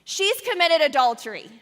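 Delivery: loud, high accusing yell from the mob; angry mob member shouting an accusation. Acted. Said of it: "one of them shouts".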